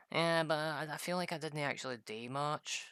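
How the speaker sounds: mock whiny friend. Friend's retold complaint.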